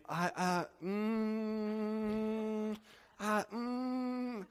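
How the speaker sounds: high, strained hesitant hums; flustered stammer. Retold or imitated. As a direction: squirm for a way out. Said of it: "And he".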